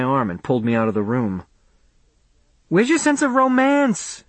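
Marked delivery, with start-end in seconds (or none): 2.7-4.2 much higher, insistent tease; insisting. A narrator's reading. Faye teasing Charlie into dancing, insistent. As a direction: push him playfully, high. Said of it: "she insisted".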